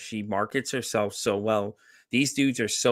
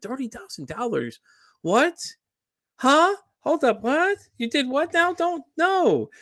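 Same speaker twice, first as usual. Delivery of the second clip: mock shock at thirty grand, pitched way up; incredulous; 13 semitones higher, slower than usual. Retelling to a friend how he reacted to the scam victim, shrill disbelief.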